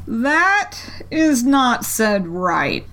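very slow, disapproving caterpillar. A narrator's reading. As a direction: drawl the verdict. Caterpillar voiced in story.

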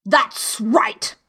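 loud, forceful royal voice; imperious, punchy yell; shouted approval. A narrator's reading. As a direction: shout it like a commanding queen. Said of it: "shouted the queen".

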